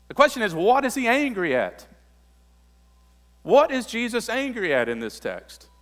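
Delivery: rising, loud, insistent preaching; preaching at full force. Acted. Preacher to congregation on Jesus' anger, loud and rising. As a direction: drive the outrage home from the pulpit.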